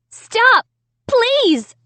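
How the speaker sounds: high, loud desperate plea; pleading. A narrator's reading. Amy pleading with the dummy, high and desperate. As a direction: beg him to stop. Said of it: "I pleaded".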